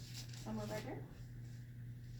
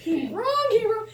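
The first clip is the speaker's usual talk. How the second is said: mock outrage; 9 semitones higher, 10 dB louder, slower than usual.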